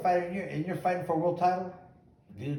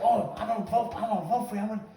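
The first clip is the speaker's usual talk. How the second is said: higher-pitched, gushing mimicry of supporters; mock eager promise; 6 semitones higher, faster than usual. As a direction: imitate everyone pledging their vote.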